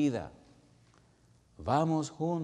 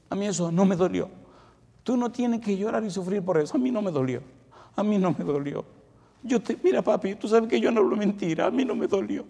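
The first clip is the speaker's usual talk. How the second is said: put-on high, louder child's insistent plea; son pleading; 9 semitones higher, 6 dB louder than usual. A son begs his crying father to stop, high and loud. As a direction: beg papi to believe it, high and urgent.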